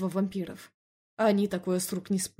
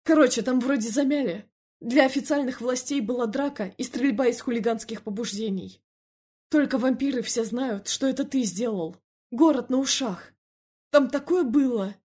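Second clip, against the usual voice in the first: higher, louder, worked-up character voice; agitated Felix figure; 4 semitones higher, 5 dB louder than usual. Acted. Telling Эмиль about the shootout, agitated. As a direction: report the trouble in an agitated, raised voice.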